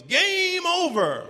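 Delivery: long, high, drawn-out referee ruling; referee's call. Retold or imitated. Ref ending the game with the ball held up, stretched out and high. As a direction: hold it up and call it final. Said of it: "and he goes".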